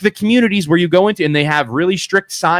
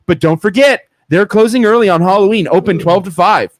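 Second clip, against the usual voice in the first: over-the-top ad read, pitched up high; fancy voice; 6 semitones higher than usual. Pretend. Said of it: "read it in my fancy voice".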